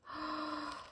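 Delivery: soft, high gasp of wonder; real hushed gasp of delight. Real, not acted. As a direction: gasp softly at something lovely. Seeing the vintage paper up close.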